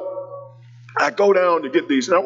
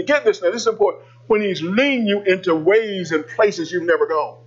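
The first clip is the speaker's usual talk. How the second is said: ordinary talk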